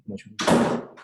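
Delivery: breathy whisper of mucho mejor. Real, not acted. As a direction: whisper it like an aside. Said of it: "whispered aside".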